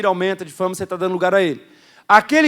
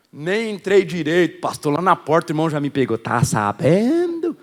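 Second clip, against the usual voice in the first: gossiping church member; 3 semitones lower than usual. Retold or imitated. Member at the door gossiping to the pastor, sly. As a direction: lean in like a gossip. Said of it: "às vezes alguém fala assim".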